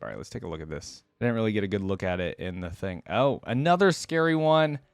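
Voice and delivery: usual speaking voice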